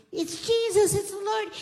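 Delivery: high, loud exclamation of recognition; excited cry. Retold or imitated. Peter sees Jesus on the beach, crying out excitedly. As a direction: shout the joyful discovery.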